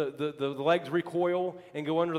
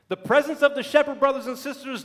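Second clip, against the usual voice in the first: louder, higher preacher's cry; preacher driving home the point; preaching at full force; 5 semitones higher, 5 dB louder than usual. Acted. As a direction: proclaim it to the congregation.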